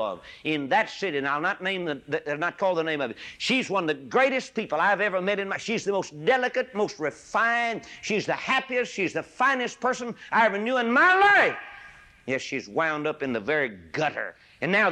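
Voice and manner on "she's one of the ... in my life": builds higher and louder to a peak; rising fervent praise. Real, not acted. Preacher building praise of the fallen lady, rising fervor. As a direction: climb with each phrase to a ringing finish.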